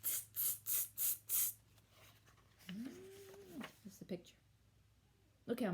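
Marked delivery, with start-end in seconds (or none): 0.0-1.5 quick voiceless "tss" clicks of mimicking monkeys; cheeky monkey tsking; monkey chatter tsks. A narrator's reading. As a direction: chitter "tss" softly, evenly, without voice.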